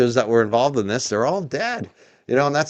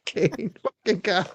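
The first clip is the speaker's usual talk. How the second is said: breathless laughing scoff; wheezing laugh; 6 semitones higher, 8 dB quieter, slower than usual. Real, not acted. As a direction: laugh the name out. Laughing at Kane.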